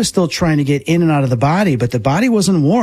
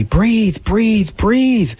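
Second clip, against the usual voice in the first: retold yelling; 9 semitones higher, much slower than usual. Yelling at own body to breathe, urgent mock yell. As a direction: urge each word out in a raised pitch. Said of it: "So I'm yelling at my body".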